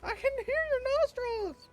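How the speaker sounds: very high teasing; mock-squeal at the friend; playful squeal; squeaky tease. Real, not acted.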